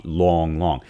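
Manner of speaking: long long, both held heavy and even; two equal heavy beats; spondee rhythm. Shown as a demonstration. Teacher demonstrates the spondee foot, heavy and even. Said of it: "or it can be a spondy".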